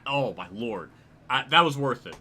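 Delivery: ordinary talk, nothing marked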